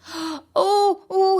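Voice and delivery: high, loud startled gasps. A narrator's reading. Mouse dentist inside the fox's mouth, gasping. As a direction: gasp in shock at the stench. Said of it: "he gasped".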